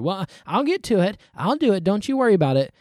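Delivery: high-pitched mock reassurance; acted husband putting it off; procrastinating husband. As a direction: brush off her reminder. Procrastinating husband answers wife's reminder.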